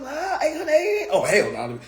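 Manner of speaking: high mock gasp at the price tag, then a flat refusal; shocked at the price. Shopper checks the price tag, mock shocked. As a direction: play a shopper reading an outrageous price.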